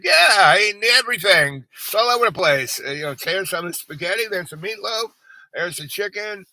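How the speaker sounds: hammed-up carefree patient; cheeky mock reply. Retelling his own cheeky reply.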